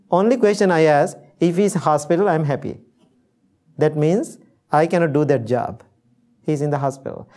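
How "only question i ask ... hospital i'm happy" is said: ordinary delivery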